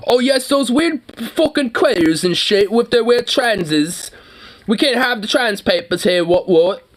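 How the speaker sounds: high, slow mock British accent. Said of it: "in that accent".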